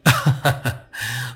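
short, mocking laugh; villain's gloating chuckle. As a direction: chuckle at the victim's rage. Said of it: "voice on the radio laughed".

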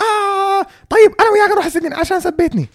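high, rushed squealing imitation; mock angry woman. Woman threatening jail over an insult, high mock outburst. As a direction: burst out in a rapid high mock voice. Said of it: "And he's like".

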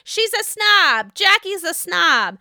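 high, loud, drawn-out mock accusation; mock her complaint, dragging the words; Jennifer Aydin. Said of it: "This is Jennifer Aydin".

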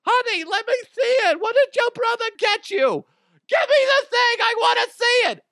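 high, loud shrieking mom voice; hammed-up squealing mother; mock excited mom. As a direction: mom begging to see the gift. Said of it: "And I'm like".

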